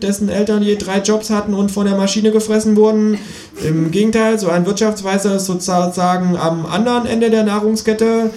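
high, pert kangaroo voice lecturing; sassy kangaroo holding forth; cheeky kangaroo. Acted. Kangaroo mocking the economist on live TV, high sassy voice.

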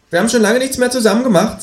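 high, fast reproachful kangaroo. A narrator's reading. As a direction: accuse with a whine. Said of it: "in vorwurfsvollem Ton".

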